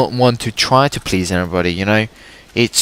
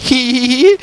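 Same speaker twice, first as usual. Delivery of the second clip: high teasing giggle; mimicking girls giggling; mock girly giggle; 12 semitones higher, 3 dB louder, much faster than usual. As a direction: giggle like the girls laughing at you.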